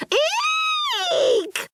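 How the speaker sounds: very high piercing shriek. A narrator's reading. Customer frightened by mice, piercing shriek. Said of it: "the customer shrieked".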